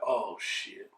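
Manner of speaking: low, soft "oh shit" of dread; dread under the breath. Retold or imitated. Boy hearing his mother call him, low soft dread. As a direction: mutter it like doom is coming. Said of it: "I'm like".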